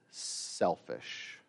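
soft, breathy selfish under the breath; whispered. Acted. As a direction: drop to a hush on selfish. Whispered judgment word.

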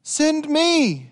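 timid volunteer finding courage; Isaiah answering God in fear, then offering himself; afraid Isaiah. Acted. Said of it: "he's afraid".

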